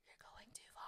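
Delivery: hushed whisper to self; soft whispered self-rebuke. Real, not acted. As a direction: breathe it as a private aside.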